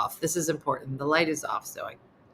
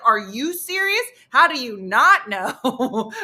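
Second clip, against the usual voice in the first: exasperated; 3 semitones higher, 6 dB louder than usual. Retold or imitated. Partner stunned that she doesn't know, exasperated. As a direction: imitate him aghast, drawing out the last words. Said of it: "his exasperation".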